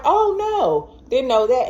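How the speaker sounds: very high "oh, no"; dismayed. Retold or imitated. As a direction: squeal in alarm. Dismayed again.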